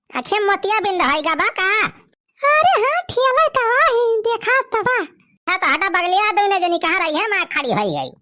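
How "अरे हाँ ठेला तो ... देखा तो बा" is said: village wife voice. Acted. Wife character answers.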